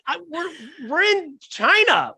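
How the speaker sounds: a hint of baffled disbelief; exasperated. Comedian telling the British comics they're in China, mildly exasperated. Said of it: "And I'm like".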